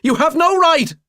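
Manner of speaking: high, loud, near-tearful protest; desperate pleading. Acted. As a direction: beg him to stop, voice cracking up high. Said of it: "Mick is almost in tears".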